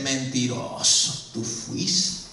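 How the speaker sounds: low, hushed, slow accusation; whispery conscience calling out a liar; inner voice of conscience. Acted. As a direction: accuse softly from inside the head. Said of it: "la voz interior te dice".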